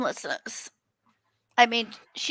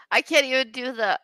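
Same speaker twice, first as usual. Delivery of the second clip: ordinary delivery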